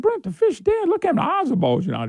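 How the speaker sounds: high, pushy retelling of the weigher; indignant fisherman. Retold or imitated. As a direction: play the exasperated tournament weigher. Retold fishing argument, [name].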